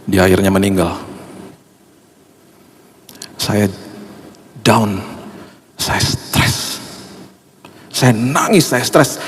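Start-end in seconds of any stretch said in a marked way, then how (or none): 3.4-9.2 hushed, breaking voice of grief; barely gets the words out; choked-up grief. Real, not acted. Pastor telling the church of his grief, hushed and broken. Pastor recalls friend's death.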